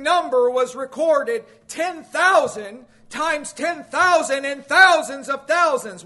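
impassioned preaching. Real, not acted. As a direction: preach the huge numbers with mounting force. Preaches at full force.

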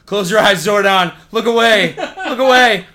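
loud, high, laughing shout; embarrassed shouting. Pretend. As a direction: yell it out while laughing. Pretend embarrassed fan shouting.

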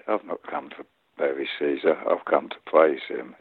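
low, hushed Mark Antony; soft acting. Actor as Antony over his dead friend, soft and low. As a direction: play it softly, bent over a dead friend. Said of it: "And I've done it softly".

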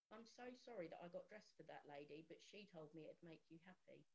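put-on soft, subdued child's apology; retold tearful apology. Upset son apologising to Mummy, softly retold. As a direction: quietly imitate a sorry little boy. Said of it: "he cried and he said".

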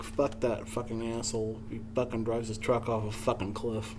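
low, soft grumbled cursing; after hanging up. Acted. Cursing a driver after hanging up, quiet grumble. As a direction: drop the act and curse under your breath. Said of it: "then you hang up the phone like".

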